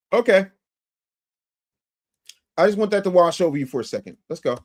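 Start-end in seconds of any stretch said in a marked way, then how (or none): none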